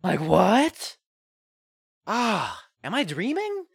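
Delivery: high, loud, breathless excitement; giddy fan. Retold or imitated. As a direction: gush like a starstruck X-Files fan. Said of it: "X-Files fan inside of me was like".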